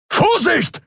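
high, urgent cry of alarm; shouted warning. A narrator's reading. Keru warns the pilot of an incoming shot, shouting. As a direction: yell out a sudden warning. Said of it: "rief Keru aus".

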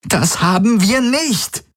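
high, drawn-out cry of denial; frustrated. A narrator's reading. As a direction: deny it slowly, pitch right up. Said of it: "Kirai schlug frustriert gegen die Wand".

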